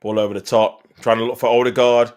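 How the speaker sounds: ordinary delivery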